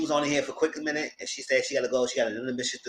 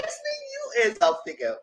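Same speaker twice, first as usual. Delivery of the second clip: mock incredulous. Mocking Destiny.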